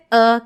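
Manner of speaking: "oh" as a schwa from the throat; "oh" loud, a throaty schwa sound. Shown as a demonstration. Teacher isolates the schwa "oh" loudly. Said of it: "que sale desde la garganta".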